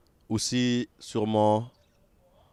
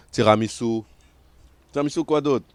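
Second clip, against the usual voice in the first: ordinary talk, nothing marked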